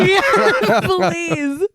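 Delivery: laughing through the words; giggly, high with laughter. Real, not acted. Laughing at the absurd scene.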